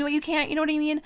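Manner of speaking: high, sing-song brag; a squeaky playful gloat; sassy mock boast. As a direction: tease them with a high smug brag. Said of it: "my tone with them is very, like".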